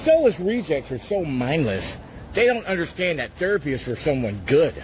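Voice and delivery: ordinary delivery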